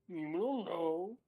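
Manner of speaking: put-on pouting toddler. Huffing at being told he has no power, pouting. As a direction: huff it like a sulky two-year-old. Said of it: "like a two-year-old pouting".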